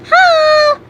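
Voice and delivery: very high, loud squeak; squeaky voice. A narrator's reading. Answering silly Gaster back, extremely squeaky voice. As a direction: squeak it out in a rush of silliness. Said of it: "back in the most squeaky voice ever".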